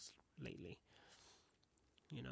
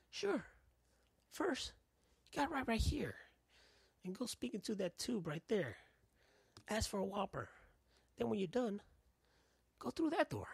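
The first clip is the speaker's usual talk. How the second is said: much higher put-on clerk voice; fast-food worker impression; 7 semitones higher than usual. Worker sending customer from door to door, high mock clerk voice. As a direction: pitch the counter worker way up. Acting Burger King worker sending him around.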